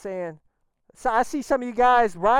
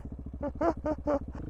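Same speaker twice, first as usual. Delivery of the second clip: a soft, relieved little laugh; nervous laugh; 5 semitones higher, 10 dB quieter than usual. Real, not acted. Rider laughing off the stall to viewers, soft relieved laugh. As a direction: laugh off the scare. Nervous after the engine cut.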